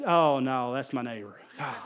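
low, soft letdown with a laugh; deflated. Retold or imitated. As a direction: let the hope drain out. Disappointment, it's a neighbor.

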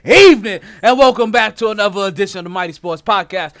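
loud, upbeat show opener; podcast host intro. Acted. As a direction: hype up the audience.

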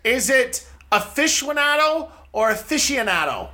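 first AFICIONADO as fish-WON-ah-do, then as fishy-uh-NAH-do; aficionado both ways. Shown as a demonstration. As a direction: contrast a-fishwo-nado with a-fishy-anado. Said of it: "There's no U".

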